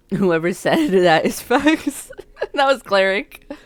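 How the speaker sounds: loud, slow words broken by laughter; laughing. Real, not acted. Player agreeing with a chat comment, laughing hard. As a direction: crack up while you speak, drawing it out.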